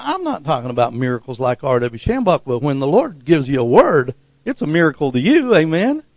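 ordinary delivery

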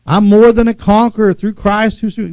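quoting scripture with conviction; confessing scripture. Acted. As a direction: declare the promises boldly.

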